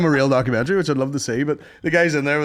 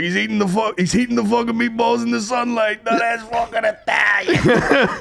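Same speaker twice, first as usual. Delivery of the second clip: proud mock shout; 8 semitones higher than usual. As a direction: bellow it with delight. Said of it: "He's like".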